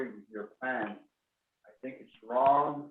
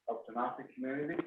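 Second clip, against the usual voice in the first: ordinary talk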